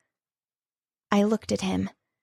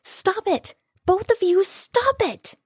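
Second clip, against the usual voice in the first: much higher, desperate scream to stop; 12 semitones higher than usual. A narrator's reading. She screams at the two brawling men, much higher. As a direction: shriek at them to stop fighting. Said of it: "I screamed".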